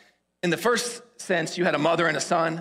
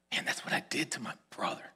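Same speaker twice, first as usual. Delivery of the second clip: soft, half-whispered self-reproach; hushed realization; 3 semitones lower, 8 dB quieter than usual. Retold or imitated. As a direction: let it dawn on him, almost whispering. Acted dawning guilty realization.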